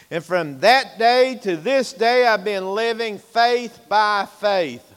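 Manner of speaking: loud, high preacher's cry; loud, high, ringing sermon delivery; full-force preaching. Real, not acted. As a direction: preach it out to the back pew.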